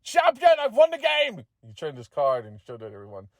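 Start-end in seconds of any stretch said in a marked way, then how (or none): 0.0-1.4 high, loud triumphant shout. A narrator's reading. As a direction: yell it out like you just won. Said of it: "McGeady shouted".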